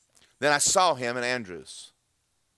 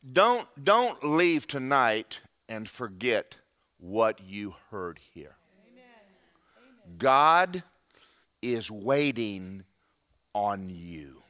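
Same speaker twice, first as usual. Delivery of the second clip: solemn sermon close; 4 semitones lower, much slower than usual. Acted. Preacher urging congregation not to forget tonight, slow solemn plea. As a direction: land each phrase with long, weighty pauses.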